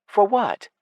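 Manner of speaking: put-on high young woman's voice; light, high elf girl. A narrator's reading.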